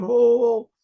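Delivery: high, loud shouts of joy; joyful yelling. A narrator's reading. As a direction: whoop it out, jumping for joy. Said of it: "he hears yelling, screaming".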